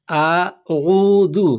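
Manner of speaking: আউযু stretched out syllable by syllable; very slow recitation. Shown as a demonstration. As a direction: drag out আউযু very slowly for learners to copy. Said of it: "এখানে". (খুব ধীরে টেনে উচ্চারণ)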